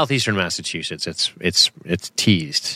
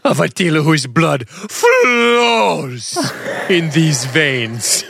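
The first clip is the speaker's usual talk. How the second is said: slow, higher theatrical boast; hammed-up villain; 3 semitones higher, much slower than usual. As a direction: draw out each word like a mock warlord. Hammed-up quote of a film villain.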